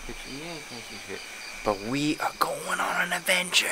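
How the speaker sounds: breathy hushed whisper. Real, not acted. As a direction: whisper it in the dark. Hushed intro at night outside.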